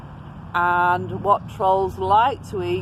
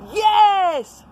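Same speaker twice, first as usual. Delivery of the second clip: high, loud delighted cheer; pirates shout; 14 semitones higher, 8 dB louder than usual. Acted. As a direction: shout it together with joy. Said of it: "shouted the pirates".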